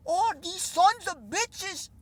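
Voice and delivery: mock him slowly, like a kid swearing for the first time; Jordan Peterson impression. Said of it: "my best imitation of a Jordan Peterson".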